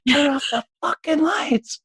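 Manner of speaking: high-pitched, drawn-out mock scream; mock frantic yell. Director bursting into the lighting room, mock frantic high yell. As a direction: imitate a panicked director yelling, very high. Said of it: "where I'm just like".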